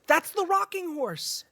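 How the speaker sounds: high, slow dread; spooked. Acted. Friend recognizing the moved rocking horse, high and slow with dread. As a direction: stare at it in disbelief.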